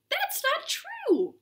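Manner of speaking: slow squeaky protest; high mock outrage.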